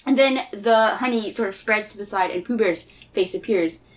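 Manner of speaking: plain reading voice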